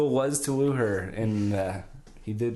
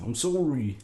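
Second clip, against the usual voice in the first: put-on a light, pushy Nova Scotia accent; hint of an aggressive East Canadian accent; 2 semitones higher than usual. Cohost mimicking the character's Nova Scotia accent. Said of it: "it's a little bit more aggressive".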